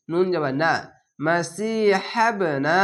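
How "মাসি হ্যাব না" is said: slow "মাসি হ্যাব না" with ব bounced; drawn-out recitation of "মাসি হ্যাব না", bouncing ব; qalqala bounce. Shown as a demonstration. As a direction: hold সি, release the ব of হ্যাব with an echo. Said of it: "এখানে কলকলা".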